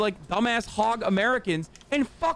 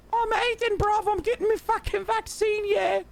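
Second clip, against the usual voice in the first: much higher, cartoonish London lad accent; British bruv mockery; 11 semitones higher than usual. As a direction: play a dopey British bloke proudly announcing. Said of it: "we make fun of bruvs".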